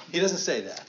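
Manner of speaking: ordinary talk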